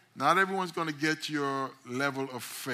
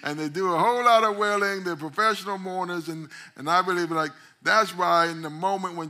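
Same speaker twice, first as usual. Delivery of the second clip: full-force preaching; 6 semitones higher, 10 dB louder than usual. Acted.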